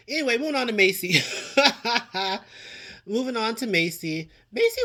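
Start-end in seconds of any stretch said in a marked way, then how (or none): 0.1-1.2 giggling through the words, quick and loud; laughing. Real, not acted. Vlogger laughing as she moves on to Macy. Laughing while switching topics. 1.3-3.0 real laugh. Real, not acted. Laughing while switching topics.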